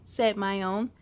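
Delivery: ordinary delivery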